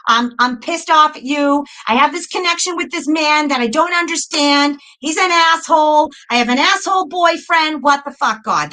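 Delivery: defiant refusals climbing into a high-pitched complaint; pissed-off rant. Retold or imitated. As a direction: argue with God, increasingly worked up. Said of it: "That's how I was".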